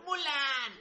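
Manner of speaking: high-pitched, drawn-out call of the name; mock cartoon holler at ordinary volume; Mushu. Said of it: "por como grita [name] al micrófono".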